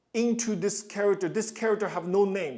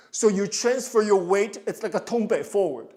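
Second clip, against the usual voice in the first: ordinary talk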